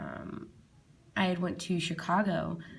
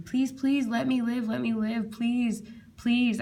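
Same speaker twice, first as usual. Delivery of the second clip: high, louder pleading prayer; desperate prayer; 5 semitones higher, 4 dB louder, slower than usual. Retold or imitated. Praying for her life during the war news, high and desperate. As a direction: beg for your life.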